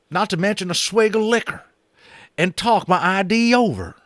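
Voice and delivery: a hard-drinking cowboy pitching his idea; Boone. Acted. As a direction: Boone urging the boys to fetch whiskey, high and loud. Boone the cowboy proposes whiskey.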